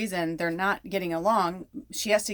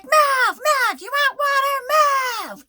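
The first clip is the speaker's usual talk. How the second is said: very high, loud, furious mock yelling; imitated angry screaming; 10 dB louder, much slower than usual. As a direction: scream the orders at the old horse. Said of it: "she's, like, screaming".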